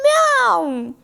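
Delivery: high, playful meow; kitten meow. Retold or imitated. Teacher to students, imitating the kittens with a high meow. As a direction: mew like a tiny kitten.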